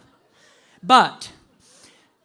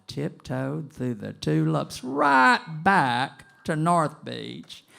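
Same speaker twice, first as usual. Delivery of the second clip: slow, building to a loud finish on the dollars; triumphant drawn-out punchline; 3 semitones higher, 13 dB louder, much slower than usual. Retold or imitated. Storyteller gloating to the audience, slow and rising to loud. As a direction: savour the getaway, then blast the last words.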